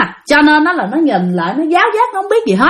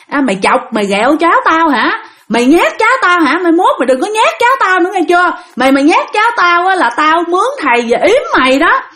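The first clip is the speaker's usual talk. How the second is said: higher-pitched scolding, acted; angry father; 7 semitones higher, faster than usual. Father threatens the ghost that scared his child, angry scolding. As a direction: warn off a ghost in mock fury. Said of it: "tức lắm".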